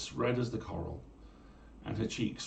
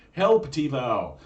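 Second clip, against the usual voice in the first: loud, slow battle cry; cried out; 6 semitones higher, 10 dB louder, much slower than usual. Acted. Conchobar calling on Tival in battle, loud slow cry. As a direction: call her name into battle.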